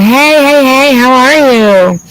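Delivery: high, slow, sing-song mock greeting; imitating Shane. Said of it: "Shane's like".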